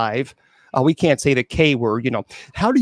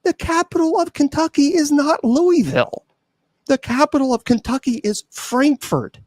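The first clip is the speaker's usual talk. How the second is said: exasperated high pitch; 10 semitones higher than usual. Real, not acted. Host explains the trick to Wilma, high-pitched exasperation. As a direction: voice pushed way up in disbelief.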